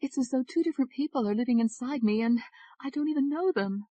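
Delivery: trembling. Acted. Ashley confessing her fear to David, trembling. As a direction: let the fear rise as she confesses. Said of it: "Her voice was trembling".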